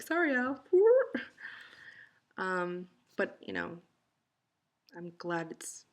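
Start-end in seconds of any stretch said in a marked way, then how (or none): 0.0-0.6 high, loud, sheepish apology; embarrassed apology. Real, not acted. Apologizing for passing gas. 0.7-2.1 loud squealing laugh; high burst of laughter. Real, not acted. Laughing after apology.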